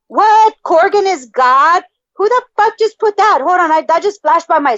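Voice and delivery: high, loud disbelief; shocked outrage. Real, not acted. Reacting to [name] is God in chat, shocked. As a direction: react like it just hit you.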